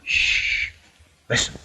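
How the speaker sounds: hushed whisper. Acted. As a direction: hush everyone, whisper the warning. Hiding in ambush.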